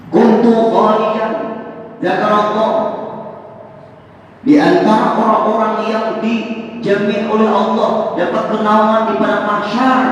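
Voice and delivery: loud, high fervent preaching. Acted. Preacher warning the congregation, loud and fervent. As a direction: hammer the point home to the hall.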